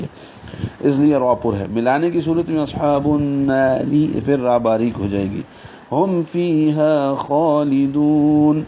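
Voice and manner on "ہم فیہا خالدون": slow, higher chanted recitation; tajweed recitation of the verse ending; Quranic recitation. Shown as a demonstration. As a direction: stretch the vowels in a melodic recitation.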